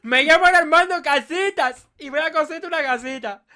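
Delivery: high, slow, laughing mock builder voice; silly builder character. Introducing himself to his friend as a goofy builder, slow and laughing.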